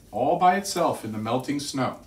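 ordinary delivery

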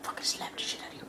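fast whispered threat; whispered mock threat. YouTuber joking he'd slap a spammer, fast whisper. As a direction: hiss a joking threat quickly.

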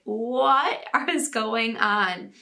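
slow, drawn-out bewildered question; stunned disbelief. Retold or imitated. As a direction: stretch it out like a baffled parent. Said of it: "I bet you were just like".

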